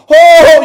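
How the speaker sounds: loud, high anguished "oh"; passionate moan. Acted. Preacher shows the passion in the word, anguished moan. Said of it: "there is passion".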